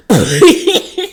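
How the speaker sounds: a real high laugh bursting out; cracking up at the comeback. Real, not acted.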